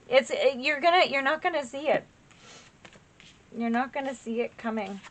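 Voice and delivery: choked up, fighting back tears; words catching as she tears up; real emotion breaking through; getting teary. Real, not acted. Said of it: "I'm getting teary".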